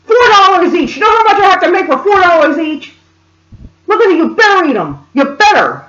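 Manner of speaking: high mock rant at the kids; imitated screaming. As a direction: berate them as the furious mother. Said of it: "then she started screaming".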